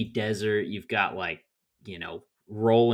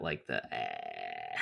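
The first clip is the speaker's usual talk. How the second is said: low creaky fry imitation; dropping into a vocal fry croak; 12 dB quieter, slower than usual. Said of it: "she's got a little of that".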